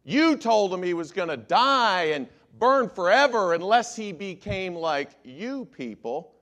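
a lawyer hammering an accusation at the church; accusing lawyers. Retold or imitated. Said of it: "the lawyers come forward and say".

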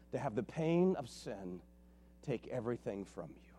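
breathy whisper, nearly no voice; whispered aside. Real, not acted. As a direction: let it sink to a whisper. Pastor drops voice on sin.

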